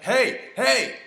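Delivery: loud, high-pitched mock holler; imitated shout. Stranger turns to him in the street, imitated shouting. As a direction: holler like a stranger accosting someone.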